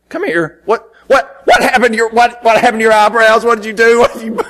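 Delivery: a horrified aunt, voice climbing; aunt flipping out. Retold or imitated. Aunt confronting the nephew without eyebrows, frantic. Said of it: "she just flipped out".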